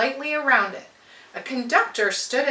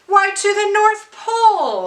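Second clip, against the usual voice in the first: proud announcement; 9 semitones higher, 7 dB louder than usual. Acted. Conductor answers proudly.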